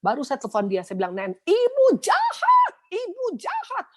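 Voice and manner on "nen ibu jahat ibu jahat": imitated crying accusation. Hurt worker accuses her pastor on the phone, imitated tearful wail. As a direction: wail it, dragging out the words. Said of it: "Itu kita nangis-nangisan dua-dua".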